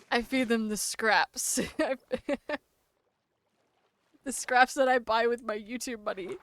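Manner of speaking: ordinary delivery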